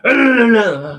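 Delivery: loud drawn-out exhale; heavy sigh. Shown as a demonstration. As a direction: let out a big weary sigh. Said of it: "then they sort of sigh".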